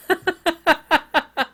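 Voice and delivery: a real, high burst of laughter; amused laughter at the accusation. Real, not acted.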